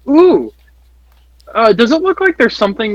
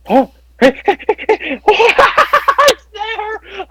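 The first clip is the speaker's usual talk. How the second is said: giggling squeals of delight; delighted laughing; slower than usual. Real, not acted. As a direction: laugh with excited joy. Finding the item, delighted squeals.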